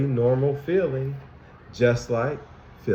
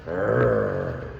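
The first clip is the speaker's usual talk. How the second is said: very deep, drawn-out mock growl; low growl; 14 semitones lower than usual. Teacher showing kids Anger's face, deep playful growl. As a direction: rumble it like an angry cartoon.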